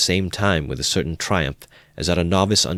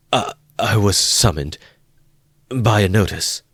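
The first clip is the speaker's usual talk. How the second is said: faltering; 4 semitones higher, 5 dB louder than usual. A narrator's reading. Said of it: "Raskolnikov faltered".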